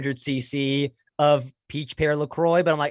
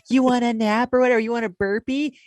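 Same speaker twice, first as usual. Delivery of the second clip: put-on higher, louder sing-song question to a baby; baby talk; 9 semitones higher, 3 dB louder than usual. Mom asking her baby a question, higher baby-talk voice. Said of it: "Like the way you'd ask a baby a question".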